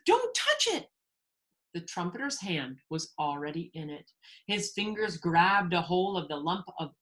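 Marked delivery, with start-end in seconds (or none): 0.0-0.9 very high urgent cry; acted panicked warning; shouted warning. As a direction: shout to stop someone in time. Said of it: "shouted Bartholomew".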